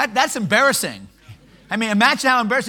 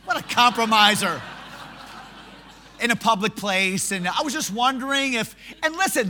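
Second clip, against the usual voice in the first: ordinary delivery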